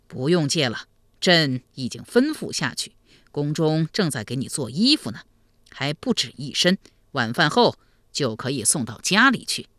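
calm, measured elder; kindly emperor uncle. Acted. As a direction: reassure him as a generous ruler.

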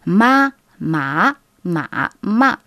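ma, ma, ma, ma run through all four Mandarin tones in order; four tones drill. Shown as a demonstration. Teacher models the tone drill Chinese learners use. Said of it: "c'est une technique".

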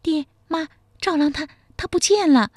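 breathless panic. A narrator's reading. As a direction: blurt it out high and breathless. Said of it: "气喘吁吁地说".

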